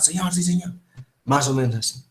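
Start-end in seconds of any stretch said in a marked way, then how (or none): 0.0-0.7 crisp military reply; like a soldier. Retold or imitated. Teacher imitating a soldier answering an officer. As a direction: answer like a recruit to a sergeant. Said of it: "Así como soldado".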